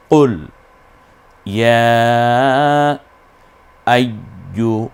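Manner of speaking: drawn-out chanted phrase; slow recitation. Shown as a demonstration. Teacher recites again for students to follow. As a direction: stretch yeah long. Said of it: "আর একবার পড়ুন".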